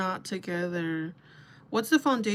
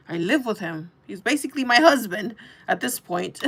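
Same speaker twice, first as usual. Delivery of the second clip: indignant exclaim; 2 semitones higher, 9 dB louder than usual. Retold or imitated. Outraged retort to drunk friend.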